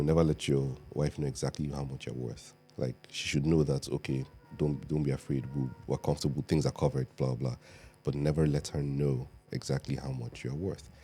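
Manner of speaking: ordinary talk, nothing marked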